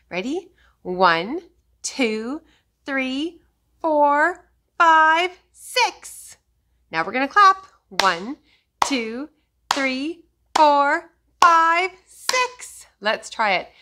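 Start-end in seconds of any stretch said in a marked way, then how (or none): 0.8-6.4 counting that climbs higher and louder to a shout; building count. Shown as a demonstration. 7.9-12.9 counting that climbs higher and louder to a shout; building count. Shown as a demonstration.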